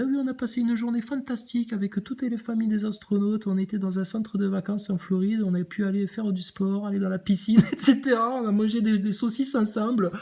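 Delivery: high hammed-up southern French accent; mock southern French accent. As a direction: mimic her gushing in a southern French accent, pitched high. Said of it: "elle disait".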